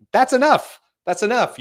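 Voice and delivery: high, loud outburst easing slightly; emphatic mock protest. Arguing it needn't rule the world, loud emphatic protest. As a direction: burst out high and loud, then ease off.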